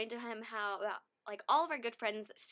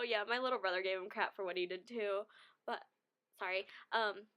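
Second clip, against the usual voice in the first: normal talking voice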